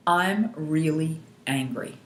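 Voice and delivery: slow, measured statement, word by word; stating the problem. Shown as a demonstration. Coach leading tapping on the eyebrow point, slow deliberate statement. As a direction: state it deliberately for others to echo. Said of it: "we wanna feel the anger while we're tapping".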